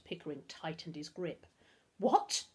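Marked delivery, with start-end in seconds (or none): 2.0-2.4 higher, startled splutter. A narrator's reading. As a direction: splutter in disbelief. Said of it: "Humphrey spluttered".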